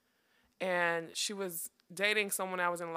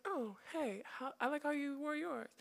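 the same compliment echoed back higher and softer, fumbling; awkward echo; 7 semitones higher, 7 dB quieter than usual. Retold or imitated. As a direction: play it as a stoned, awkward copy. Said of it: "a little bit stoned, so I was probably a little awkward".

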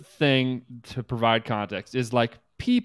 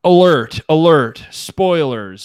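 loud, higher alarm call, hammed up; mock alarm; 5 semitones higher, 11 dB louder, slower than usual. Warning listeners before spoilers, loud mock alarm call. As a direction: sound the alert like a siren.

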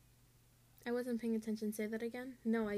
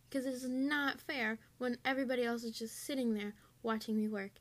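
ordinary talk, nothing marked

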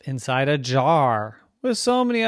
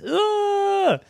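very high, loud squeal of disgust; disgusted ugh; 6 dB louder than usual. Acted. Car imagines the dog chewing, disgusted.

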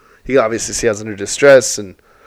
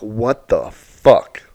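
slow, stunned what the fuck; stunned disbelief; 2 semitones lower, much slower than usual. Retold or imitated. Owner just told his dog was put down, slow and stunned. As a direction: let it fall out slowly in shock.

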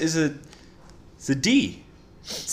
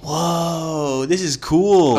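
excited; 4 semitones higher, much slower than usual. Real, not acted. Excited by connection.

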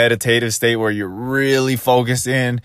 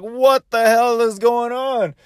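exasperated; 9 semitones higher, slower than usual. Retold or imitated. Annoyed at lady entering pool.

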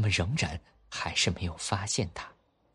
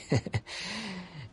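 put-on child's giggle; 11 semitones higher, 6 dB quieter than usual. Kids laughing at the boat.

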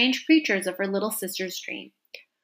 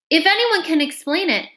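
Alice voice; 8 semitones higher, 5 dB louder than usual. Acted. Alice interrupts king.

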